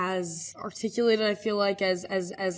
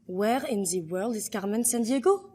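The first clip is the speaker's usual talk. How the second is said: French-accented English rising at the end; "the" as "zee" in a French accent; 2 semitones higher than usual. Shown as a demonstration. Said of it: "how you would say it in French".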